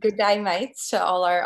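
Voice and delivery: playful Australian greeting to friends; mock Australian accent. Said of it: "That was terrible accent".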